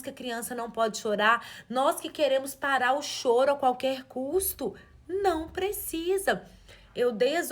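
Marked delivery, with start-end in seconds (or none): none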